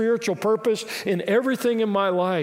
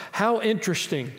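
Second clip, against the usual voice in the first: ordinary talk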